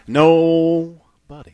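very slow, stretched-out slogan; drawn-out tagline. Pretend. Host reading the tire sponsor's slogan, drawn out. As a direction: stretch the word out long.

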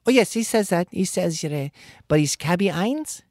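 high, confused insisting; caller voice. Retold or imitated. Bill collector insisting on the wrong name, high.

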